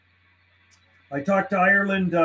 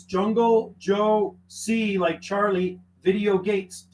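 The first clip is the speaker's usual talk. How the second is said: Jungle spoken very slowly, each part set apart; slow spelled-out callsign; much slower than usual. Shown as a demonstration. Radio operator spelling his callsign for a caller, slowly. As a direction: spell it out slowly so they can copy it. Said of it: "With a C in the middle".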